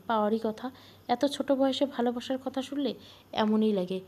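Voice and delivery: plain reading voice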